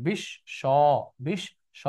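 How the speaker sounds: বিশ্ব split as "bish... sho", doubled sh and closing o; final o sound. Shown as a demonstration. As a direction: break বিশ্ব after the hushing "bish", then "sho". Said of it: "অ থাকলে ও হয়ে যাবে".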